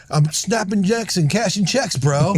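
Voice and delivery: hammed-up aggressive bro bragging. Reshot scene, Will's character brags like an aggressive bro. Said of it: "wanted him to be more aggressive".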